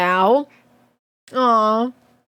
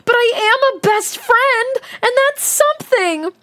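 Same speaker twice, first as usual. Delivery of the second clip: put-on high, bubbly girl voice; delighted squeal of a character; 12 semitones higher than usual. A narrator's reading. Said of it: "she squealed and squirmed and jumped up and down".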